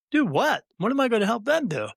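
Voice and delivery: high, startled question; incredulous. Retold or imitated. The speaker to the medium, baffled and surprised. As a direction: caught completely off guard.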